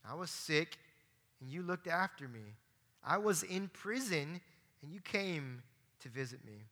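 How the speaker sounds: soft, measured reading of the verses; hushed scripture reading. Acted. Preacher reading the verses to the congregation, soft and measured. Reads scripture passage aloud.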